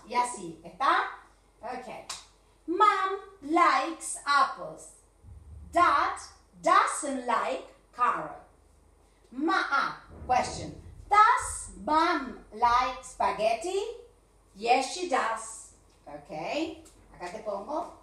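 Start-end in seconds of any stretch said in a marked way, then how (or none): 2.7-8.4 slow, loud teacher dictation; slow and loud dictation, word by word; slow drill sentences. Shown as a demonstration. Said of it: "drills example sentences". 11.1-13.8 slow, loud question with rising tune; slow loud drilled question. Shown as a demonstration. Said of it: "drills example sentences". 14.6-15.7 slow, loud teacher dictation; slow and loud dictation, word by word; slow drill sentences. Shown as a demonstration. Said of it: "drills example sentences".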